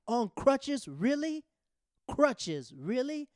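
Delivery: high, loud mock disbelief; incredulous outburst. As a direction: act out a friend calling you out, loudly. Friend confronts him, acted out.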